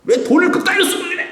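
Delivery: acted husband's scolding, very high-pitched; hammed-up nagging over money; harsh scolding. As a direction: tell off your wife in a high mock outburst. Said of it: "막 부인한테 막 호되게 꾸짖고요".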